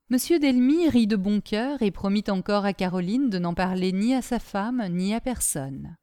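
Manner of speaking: ordinary delivery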